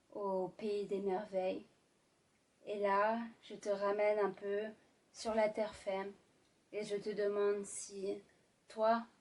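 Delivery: sultry narrator. Acted. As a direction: draw them into a romantic scene. Sultry ASMR romantic narration.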